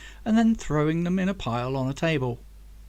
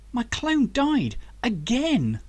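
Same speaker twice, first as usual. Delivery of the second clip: much higher, slow, disbelieving cry; shocked outburst; 9 semitones higher, slower than usual. A narrator's reading. Zach realizes his clone died, slow shocked cry. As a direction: let the horror land on each word.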